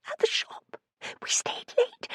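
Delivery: whispered, tearful and hushed; hiding at the shop, whispering on the phone; frightened whisper. A narrator's reading.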